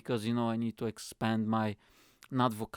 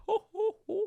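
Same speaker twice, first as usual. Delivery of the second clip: falsetto laugh. Acted. Comic falsetto laugh intro.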